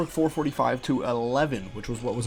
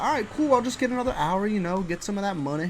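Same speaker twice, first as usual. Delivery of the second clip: much higher, sing-song fake optimism; mock cheerful; 6 semitones higher than usual. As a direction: pretend to look on the bright side.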